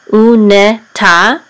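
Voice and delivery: slow, loud "u ne ta"; syllable by syllable. Shown as a demonstration. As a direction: sound out "u ne ta" as separate syllables. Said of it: "which says ta".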